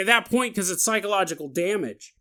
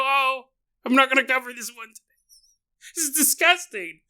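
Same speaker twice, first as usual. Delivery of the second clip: higher, fast, put-on prissy refusal; mock disgust; 6 semitones higher, 4 dB louder, faster than usual. Host pretending to refuse the gross story, mock disgust. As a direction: play at being too grossed out to go on.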